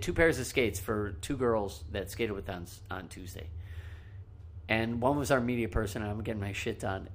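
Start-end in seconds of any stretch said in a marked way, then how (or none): none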